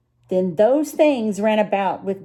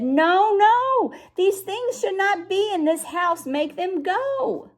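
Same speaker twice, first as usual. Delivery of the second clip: high, fretful fish voice, much higher; a worried fish scolding; fussy fish; 9 semitones higher, slower than usual. A narrator's reading. The fish in the pot protests to the cat, high and fretful. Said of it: "but our fish said".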